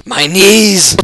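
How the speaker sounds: loud, high mock wail; mock pain cry. Player hit in both knees in game, mock pain cry. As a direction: cry out in playful agony. Character hurt in game again.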